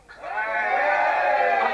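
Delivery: usual speaking voice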